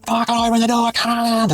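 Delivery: mock screaming. Woman yelling at the narrator to open the door, high mock scream. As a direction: imitate her screaming at the glass. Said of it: "and just start screaming".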